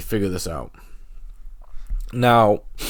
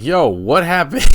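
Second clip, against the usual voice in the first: mock-startled friend, high and loud; startled reaction; 9 semitones higher, 8 dB louder than usual. Buddies checking on a fallen skier, mock startled. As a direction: imitate a stunned buddy calling out.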